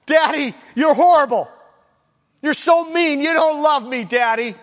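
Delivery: put-on childish protest against a strict father; whiny child. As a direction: whine at daddy like a wronged toddler. Child scolded after discipline.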